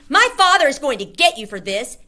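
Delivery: put-on loud, high bratty boy threat; boy shrieking threat. A narrator's reading. Spoiled boy shrieking at the narrator. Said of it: "leapt up shrieking".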